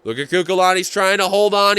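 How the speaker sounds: ordinary delivery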